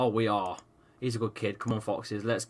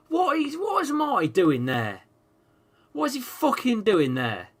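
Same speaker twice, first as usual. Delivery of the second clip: exasperated; 10 semitones higher than usual. Real, not acted. Angry at a player's mistake.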